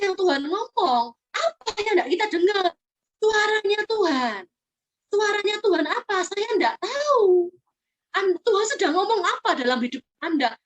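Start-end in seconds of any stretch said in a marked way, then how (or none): 3.2-7.5 slow, pressed emphasis; emphatic questioning. Real, not acted. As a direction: lean hard on each answer. (penekanan yang lambat dan tegas)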